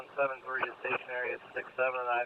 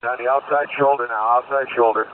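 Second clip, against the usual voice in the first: loud urgent radio call; police radio; 10 dB louder than usual. Real, not acted. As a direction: yell it over the police radio. Pursuing unit calls over radio.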